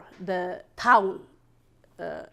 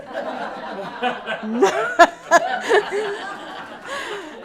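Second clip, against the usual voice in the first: long laugh; 11 semitones higher, 5 dB louder than usual. Real, not acted.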